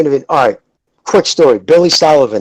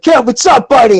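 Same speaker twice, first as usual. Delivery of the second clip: high, louder back-slapping hello; hearty mock greeting; 9 semitones higher, 4 dB louder than usual. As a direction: call it out big and friendly.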